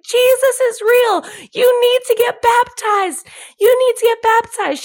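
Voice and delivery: high, loud imitation of a new convert preaching; impassioned retold street preaching; fervent preaching. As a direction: proclaim it urgently to the whole crowd. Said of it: "she starts preaching to everyone".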